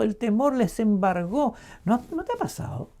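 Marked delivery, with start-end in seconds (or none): none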